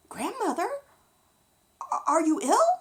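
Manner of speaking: put-on a small girl asking with concern; little girl voice; Little Red. Little Red speaks to grandmother.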